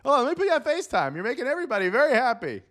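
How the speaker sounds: high, fast, delighted gushing; gushing excitement. Acted. Villager thanking Fred on FaceTime, high delighted gush. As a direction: rush it out, thrilled.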